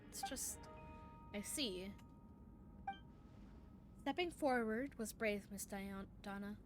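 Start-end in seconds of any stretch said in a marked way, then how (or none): none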